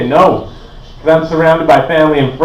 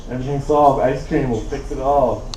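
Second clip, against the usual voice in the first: normal talking voice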